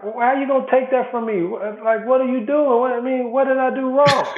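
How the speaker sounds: high, pleading disbelief, half laughing; baffled protest. Retold or imitated. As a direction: act hurt and confused, pitch way up. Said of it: "I'm looking at him like".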